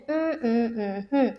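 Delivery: closed-mouth hums, one per syllable; humming the syllables. Shown as a demonstration. Teacher humming a word's syllables so students can count them. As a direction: hum each syllable with the lips shut. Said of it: "mouth बंद कीजिए और उस word को बोलिए".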